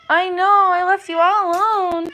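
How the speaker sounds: high, loud cooing sympathy. Real, not acted. Owner consoling cat left all alone, high baby talk. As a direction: coo an apology to a pet.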